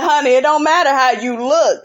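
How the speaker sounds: ordinary delivery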